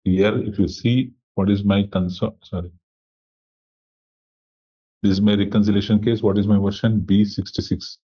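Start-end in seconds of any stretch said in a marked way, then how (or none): none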